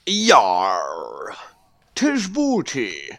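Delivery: gruff growling pirate, slow on booty. Pretend. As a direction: bellow it like a swashbuckling pirate. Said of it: "So I get to do a pirate voice all night".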